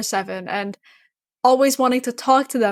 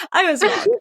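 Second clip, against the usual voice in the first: laughing at herself, higher; 7 semitones higher than usual. Real, not acted. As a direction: giggle through the admission.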